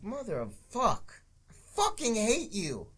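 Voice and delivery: high, slow, drawn-out mock exasperation; mock exasperated character. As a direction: whine it out slowly in a put-on voice. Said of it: "it's like".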